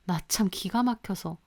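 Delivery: ordinary delivery, nothing marked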